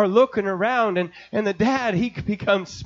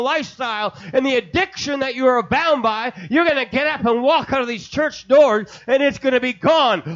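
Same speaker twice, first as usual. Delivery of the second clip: starts level, then loud, higher and shouting; the Lord pressing harder until he shouts; God's urging voice, building to a shout; 5 semitones higher, 4 dB louder than usual. Acted. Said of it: "and he says".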